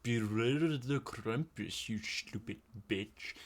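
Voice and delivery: slow, hushed warning in a mock old German grandma voice; German great-grandma. Great-grandma warns the family about Krampus, mock ominous. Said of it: "pretty much fully German".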